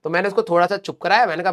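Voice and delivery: ordinary talk, nothing marked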